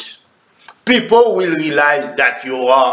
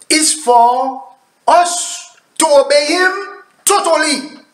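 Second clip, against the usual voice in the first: ordinary talk, nothing marked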